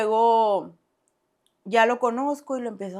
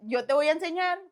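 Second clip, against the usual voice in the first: much higher, lightly voiced reassurance; reassuring boyfriend; 9 semitones higher than usual. Boyfriend reassuring her, lightly voiced and higher. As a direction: brush off her worry lightly.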